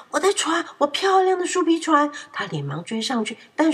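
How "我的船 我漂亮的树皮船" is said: high, loud alarmed wail; panicked cry. A narrator's reading. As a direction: cry out as the boat is swept away. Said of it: "波利惊恐地大叫". (惊恐地大叫)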